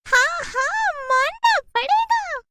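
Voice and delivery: very high, slow, loud witch squeal; shrill witch. Acted. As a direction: squeal agreement gleefully. (बहुत ऊँची चहकती चुड़ैल की आवाज़)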